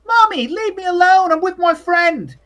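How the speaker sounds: put-on high, loud whining child; high-pitched kid calling back to his mother; little boy. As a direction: brush Mommy off like a petulant boy. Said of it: "There's the little boy".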